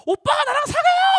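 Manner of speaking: squealing high and loud schoolgirl confession; gushing crush. Retold or imitated. Schoolgirl blurting a crush to a handsome older boy, high squeal. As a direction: blurt it out like a starstruck teen girl.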